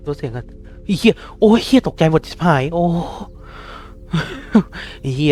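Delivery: startled swearing. Real, not acted.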